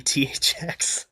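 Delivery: usual speaking voice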